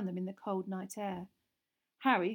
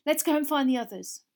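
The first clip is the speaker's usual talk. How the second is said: louder, higher; urgent; 6 semitones higher, 9 dB louder than usual. A narrator's reading. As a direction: hurry them off. Hermione pulling friends away.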